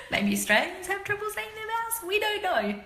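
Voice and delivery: high laughing aside; half-laughing teasing; laughing concession. Real, not acted. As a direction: giggle as you admit it. Laughing self-mocking concession.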